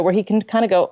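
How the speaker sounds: normal talking voice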